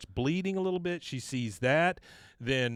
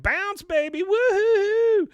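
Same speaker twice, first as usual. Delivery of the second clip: much higher, louder hooting whoop; rowdy cheer; 9 dB louder, much slower than usual. Retold or imitated. Heckler in the crowd cheering, high, loud whoop. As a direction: whoop it up like a drunk fan.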